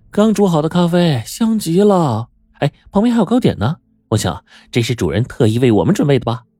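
bubbly with pleasure at the treats; delighted young girl. Acted. Ellie shows the guests the coffee and cakes, delighted and high.

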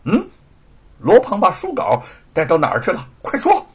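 loud, sharp demand ending high; stern interrogation. A narrator's reading. Swordswoman questioning the thief, stern demand. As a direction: demand the answer at once. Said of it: "厉声地问".

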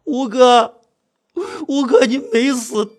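high, slow tearful wail; crying with relief. Acted. Narrator finding fifth brother alive, crying. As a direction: sob it out in relief. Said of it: "五哥看我还在那儿哭".

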